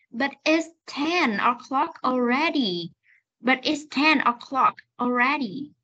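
"But it's ten o'clock already" slow, each word ending released; clear final sounds. Shown as a demonstration. Teacher corrects dropped sounds, clear slow model. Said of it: "bỏ lỡ những cái âm này".

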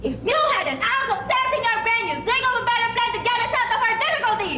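high, very fast exasperated scolding. A narrator's reading. Wife berates farmer's lack of sense, fast high scold. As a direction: rattle off the scolding, fed up. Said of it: "scolded his wife".

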